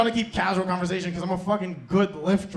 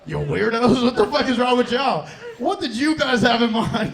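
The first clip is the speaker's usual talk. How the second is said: loud, high comic indignation; mock outrage; 5 semitones higher, 5 dB louder than usual. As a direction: scold the crowd in hammed-up disbelief. Mock scolding of the silent audience.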